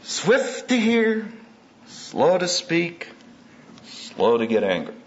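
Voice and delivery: each phrase drawn out slowly; slow, weighty reading of a proverb; slow recitation. Shown as a demonstration. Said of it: "sermon recitation of verse".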